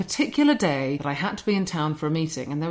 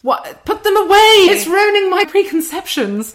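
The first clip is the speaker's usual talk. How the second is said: loud, high-pitched mock horror; exaggerated protest, pitch leaping up; mock outrage; 12 semitones higher, 9 dB louder than usual. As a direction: cry out, playfully scandalised. Mock horror at flat shoes.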